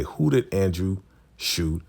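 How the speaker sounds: normal talking voice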